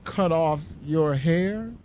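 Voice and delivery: slow, effortful question; slow bewildered husband; laborious. A narrator's reading. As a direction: drag the words out as if each costs him. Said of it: "asked Jim laboriously".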